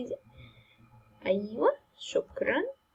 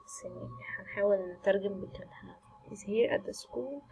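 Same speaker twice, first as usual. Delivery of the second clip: nervous hushed whisper while reading; whispering the note aloud; 2 semitones lower, 6 dB quieter than usual. Real, not acted. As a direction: breathe the words, scared of the dark school. Scared player reading note quietly.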